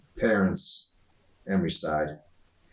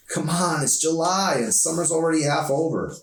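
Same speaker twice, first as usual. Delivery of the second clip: put-on higher, eager girl's voice; upbeat teen girl; 9 semitones higher than usual. Emery urges Arson to have summer fun, higher eager voice. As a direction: push him to have fun, bright and higher.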